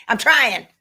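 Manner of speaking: said through a laugh; amused plea to the live viewers, still laughing. Real, not acted. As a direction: laugh while protesting. Laughing while answering comments.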